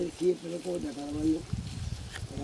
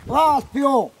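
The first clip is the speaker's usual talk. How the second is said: calling out; 7 semitones higher, 10 dB louder than usual. Real, not acted. Calling out to a passerby.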